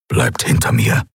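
low, growling warning; growled order. A narrator's reading. Keru to the companions behind, growling. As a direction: growl it deep down in the voice. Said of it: "knurrte Keru".

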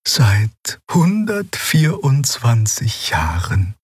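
langsam, ergeben, alte Draxyl; zögernd und langsam gestehen. A narrator's reading. (langsame, ergebene Antwort)

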